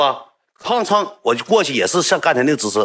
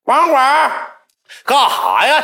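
high, loud call across the cafe; calling the attendant; 14 semitones higher, 4 dB louder, much slower than usual. Retold or imitated. Boy shouting for the attendant downstairs, loud and high.

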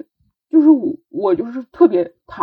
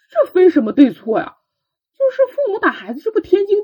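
much higher, quick scoffing rhetorical question; mock the chef's smug certainty, pitched up high; imitating restaurant owner; 10 semitones higher, 4 dB louder than usual. Chef scoffing at a crying child, high and fast. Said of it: "他就说".

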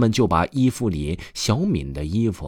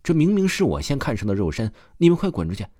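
rising, fast angry yell; angry quarrel shout; 6 semitones higher, faster than usual. A narrator's reading. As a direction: drive the others out, shrieking. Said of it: "开始你一言我一语地争吵起来".

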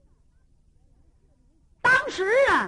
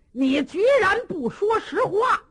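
high and loud, outraged; angry accusation; 9 semitones higher, 5 dB louder than usual. Acted. Cheng Yaojin furious at the prisoner for lying, shouting. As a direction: blast him for lying. Interrogator angered by denial.

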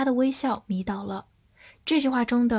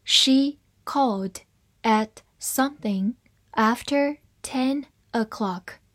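slow, one word at a time; careful word-by-word model reading. Shown as a demonstration. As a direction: read it out slowly with pauses for learners. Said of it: "好，慢慢来读".

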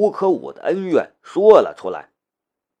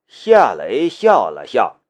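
plain reading voice

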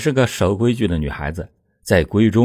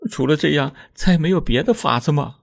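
higher woman's voice, hesitant plea; reluctant wife; 7 semitones higher than usual. A narrator's reading. Wife asks husband for another plan, higher and hesitant. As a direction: search for another way.

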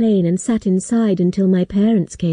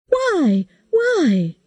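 put-on alarmed girl; 10 semitones higher than usual. A narrator's reading. Kate's alarmed question.

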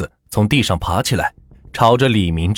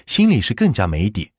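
ordinary delivery, nothing marked

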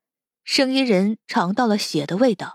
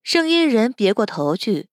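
plain reading voice

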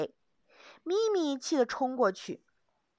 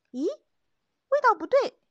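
surprised; 10 semitones higher, 8 dB louder, slower than usual. Acted. Fish tastes wrong.

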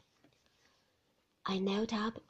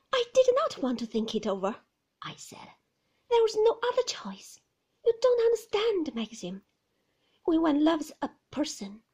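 put-on high, pleading young woman's voice, louder; anxious young wife; 6 semitones higher, 8 dB louder than usual. Young wife pleading with Maxim about visitors, higher and louder. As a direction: plead to be understood, voice lifted high. Heroine insisting.

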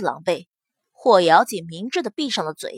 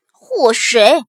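angry yell; 6 dB louder than usual. Acted. Enraged woman yells a name.

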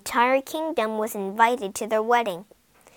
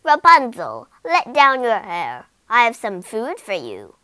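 loud call, much louder on her name; witch calling up; 8 dB louder than usual. Acted. Witch calling up to Rapunzel to let down her hair, loud. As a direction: shout up to the tower window. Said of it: "the witch called from outside".

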